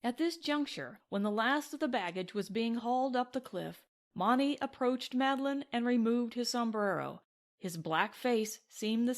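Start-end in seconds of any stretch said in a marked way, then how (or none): none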